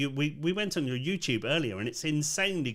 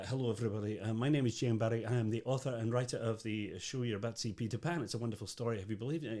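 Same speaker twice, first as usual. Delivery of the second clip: lower-pitched Scottish storyteller introduction; J M Barrie; 4 semitones lower, 6 dB quieter, faster than usual. Retold or imitated. Actor opening a Peter Pan show, Scottish accent. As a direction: greet the audience in a Scottish accent, low and soft. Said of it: "I start the show as J M Barrie".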